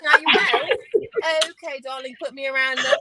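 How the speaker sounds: high, loud put-on British accent; a playful mock British greeting. Showing she is a chameleon, mock British accent. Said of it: "I'll be like".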